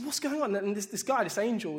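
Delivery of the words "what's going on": bewildered. Retold or imitated. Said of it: "they're just like".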